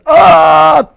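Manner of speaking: high, loud cry before falling; a sharp shriek as she collapses; sudden scream. Retold or imitated. Lady in the chairs crying out before she falls, sudden scream. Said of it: "suddenly goes".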